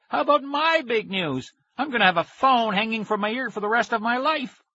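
high-pitched outraged wail; indignant cry. A narrator's reading. Boy with phone hanging from his ear, outraged wail. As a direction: rant high in aggrieved panic. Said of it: "he cried".

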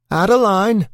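loud, high call across the house; shouting a name. Acted. Calling her name from the stairs.

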